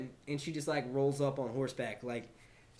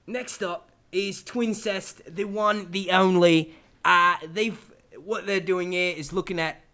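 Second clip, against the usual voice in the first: put-on Ozzy Man Australian accent; 4 semitones higher, 7 dB louder, slower than usual. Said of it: "do the accent".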